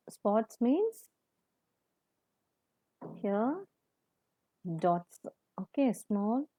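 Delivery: quiet, slow spelling out of the meaning; hushed teacher dictation; soft dictation. Shown as a demonstration. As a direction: say it softly for the class to write. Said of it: "You just listen".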